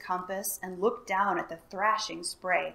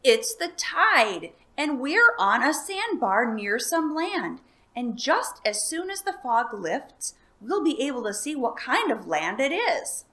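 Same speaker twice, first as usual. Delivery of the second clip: excited; 5 semitones higher, 6 dB louder than usual. A narrator's reading. Elmer excitedly explains.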